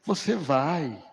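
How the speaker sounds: slow it right down and sound calmly sure; voicing the senior pastor kindly insisting; pastor Sandro. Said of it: "Aí o pastor Sandro".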